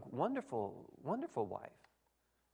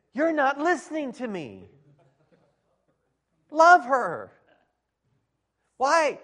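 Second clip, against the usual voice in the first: higher, loud with a laugh, then "Love her" hammered; loud mock command; 10 semitones higher, 15 dB louder, slower than usual. Pastor mirroring frustrated man, loud laughing command. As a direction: throw it back at him, laughing. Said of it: "And I said".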